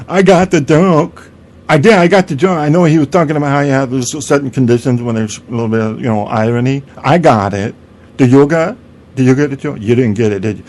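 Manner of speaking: smug know-it-all impersonation, bursting out loud at first; Andy Bong. Acted. Comedy snob boasts he got the joke, mock-smug impersonation. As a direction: crow about getting it, then needle the listener. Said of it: "That was my Andy Bong impersonation".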